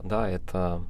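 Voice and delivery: ordinary talk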